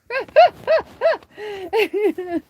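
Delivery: long, high peals of laughter; real cackling laugh. Real, not acted. Cracking up with chat over the cat, long high laugh.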